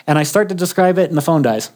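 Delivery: usual speaking voice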